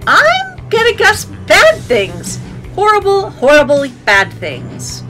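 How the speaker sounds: squeaky slow doom voice; high mock-ominous warning; high dramatic voice.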